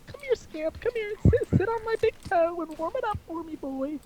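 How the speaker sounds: very high falsetto dog owner; creepy woman voice. Pretend. Dog owner calling little Scamp over, creepy high woman voice. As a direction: coo in a creepy, put-on woman's squeal. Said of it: "the creepiest woman voice".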